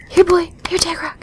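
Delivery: whispering to coax a horse. Acted. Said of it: "horse whisperer voice".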